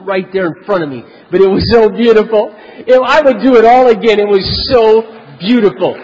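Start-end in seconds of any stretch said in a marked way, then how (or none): 1.3-5.9 loud, high, rising delight at the memory; gleeful outburst. Real, not acted. Preacher gleefully recalling his boss storming off, loud and thrilled. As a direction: savor the triumph out loud. Preacher relives the boss's anger.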